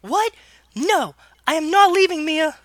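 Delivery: very high put-on girl's protest; defiant Ellie falsetto. Younger sister refusing to leave, in defiant falsetto. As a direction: refuse loudly in squeaky falsetto.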